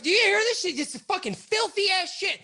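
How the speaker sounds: high, loud outraged demand; imitated indignant yell. Boss making the cops hear the call, outraged. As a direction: shout in disbelief at the cops.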